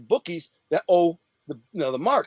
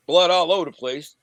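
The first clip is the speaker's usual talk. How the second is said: ordinary talk, nothing marked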